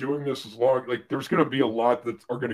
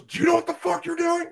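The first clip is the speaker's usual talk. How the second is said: much higher, incredulous angry yell in another voice; furious surgeon. Retold or imitated. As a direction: demand it like an outraged surgeon. Surgeon yelling at the associate.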